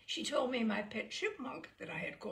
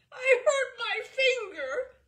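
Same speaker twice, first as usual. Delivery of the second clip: high, loud, slow sobbing wail; a hurt little boy crying out; 14 dB louder, slower than usual. Acted. Hurt boy to phone operator, wailing loud. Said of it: "I wailed into the phone".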